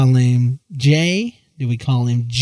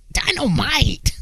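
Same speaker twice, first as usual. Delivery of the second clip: stretched-out high Dynamite shout; slow, high-pitched Dynamite!; J J catchphrase; 9 semitones higher, 4 dB quieter, slower than usual. Retold or imitated. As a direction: belt it like the sitcom catchphrase. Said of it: "he'd be like".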